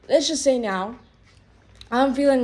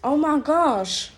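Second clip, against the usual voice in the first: exasperated outburst; 5 semitones higher, 4 dB louder, slower than usual. Real, not acted.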